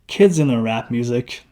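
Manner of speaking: ordinary delivery, nothing marked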